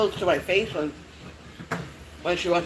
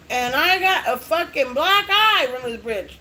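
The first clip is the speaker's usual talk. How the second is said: high, raised-voice exclamation; indignant outburst; 7 semitones higher, 4 dB louder than usual. Retold or imitated. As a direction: blurt the punchline in exaggerated outrage.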